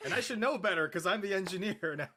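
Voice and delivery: ordinary delivery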